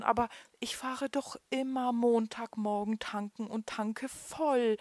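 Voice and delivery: frightened man pleads with police, voice trembling. A narrator's reading. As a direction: stutter it out, scared and pleading. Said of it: "erklärte er mit zitternder Stimme".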